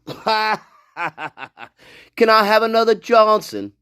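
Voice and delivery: slow, laughing through the quote; slowly laughing out the line; laughing mock quote. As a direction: drag it out while cracking up.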